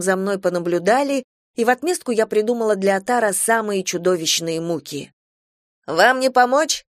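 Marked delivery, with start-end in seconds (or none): 5.9-6.8 polite call. A narrator's reading. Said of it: "вежливо крикнула компания".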